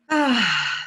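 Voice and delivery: ordinary delivery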